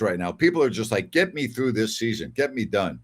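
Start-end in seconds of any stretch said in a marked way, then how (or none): none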